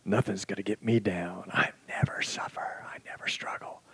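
soft, breathless bravado; mock tough-guy whisper. The world's voice boasting of never struggling. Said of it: "You gotta say".

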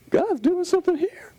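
a high, amazed Paul calling it out. Retold or imitated. Paul voiced in the retold story.

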